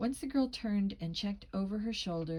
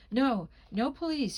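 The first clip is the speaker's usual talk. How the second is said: higher, louder pleading outcry; alarmed cry; 5 semitones higher, 6 dB louder than usual. A narrator's reading. Girl begs not to involve the police, alarmed cry. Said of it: "she cried".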